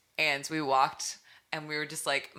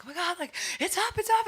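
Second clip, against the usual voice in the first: high-pitched, frantic excited squeal; freaking out; 4 dB louder than usual. Retold or imitated. Artist retelling the moment her single dropped, freaking out. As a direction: blurt the news in a giddy re-enactment. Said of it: "And I was freaking out".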